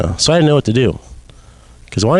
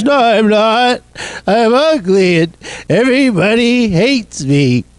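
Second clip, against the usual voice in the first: high, slow crybaby whine; crying child; 10 semitones higher, slower than usual. Retold or imitated. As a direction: wail it like a hurt toddler. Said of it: "crybaby talk".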